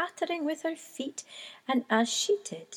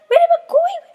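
very high, loud terrified cry; crying for help; 14 dB louder, faster than usual. A narrator's reading. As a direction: scream for help as the wind takes you. Chick blown away by wind.

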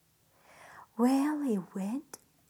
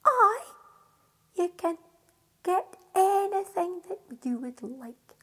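joyful fairy; 6 semitones higher, 6 dB louder than usual. Acted.

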